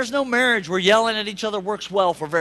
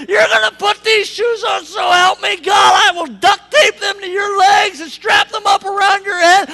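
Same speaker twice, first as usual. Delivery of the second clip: furious grandma shout; 10 semitones higher, 13 dB louder than usual. Retold or imitated. Grandma shouting at stubborn granddaughter over shoes, furious yell. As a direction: yell it loud and high, losing your temper. Grandma yelling at four-year-old.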